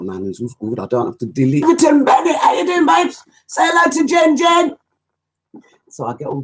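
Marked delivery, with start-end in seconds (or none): 1.6-4.8 high, loud call-out to a mate; excited shout. Real, not acted. Host excitedly shouting hello to his mate Everton on air. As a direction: shout the greeting across the room, thrilled.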